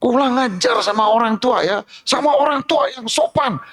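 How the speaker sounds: high, yelling mock rebuke; shouting parent. Preacher acts a parent yelling at his child about manners, high mock yell. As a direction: shout at the child like a hypocritical father, pitch way up. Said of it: "Tapi sambil teriak-teriak, bentak-bentak".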